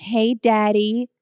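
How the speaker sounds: drawn-out American "Hey, daddy"; American accent. Shown as a demonstration. Mocking how lovers talk, put-on American accent. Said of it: "you always have to use an American accent".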